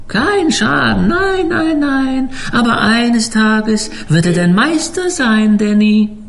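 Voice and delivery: put-on high, eerie old cook. A narrator's reading. Koch prophezeit Dan. (hoher, prophetischer Schiffskoch)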